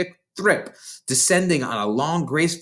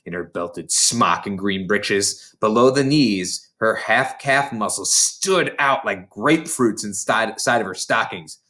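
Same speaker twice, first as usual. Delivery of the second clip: ordinary delivery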